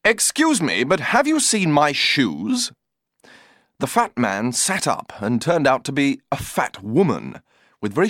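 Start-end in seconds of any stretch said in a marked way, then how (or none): 0.0-2.7 polite. A narrator's reading. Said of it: "said politely".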